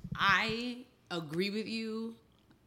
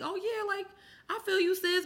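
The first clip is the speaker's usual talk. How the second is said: excited viewer; 13 semitones higher than usual. Retold or imitated. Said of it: "quoting a viewer feeling seen".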